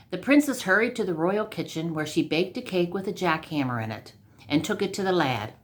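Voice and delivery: ordinary delivery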